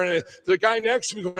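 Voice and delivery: usual speaking voice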